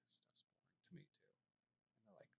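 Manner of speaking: confiding whisper; hushed aside to the tired couple. Retold or imitated. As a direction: whisper it like a secret. Confiding to clients, unvoiced.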